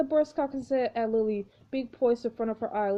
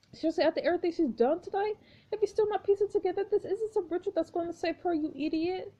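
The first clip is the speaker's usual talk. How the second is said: furious rant; 8 semitones higher than usual. Acted. Stacy furious at Noah.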